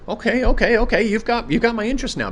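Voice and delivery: high, loud burst of sudden interest; sarcastically excited, high-pitched; mock eagerness. Mock eagerness at clip's claim.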